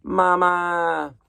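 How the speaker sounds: put-on high, drawn-out baby cry of Mama; a newborn gosling calling out to its mother. Reader voicing the hatched gosling calling Bruce mama.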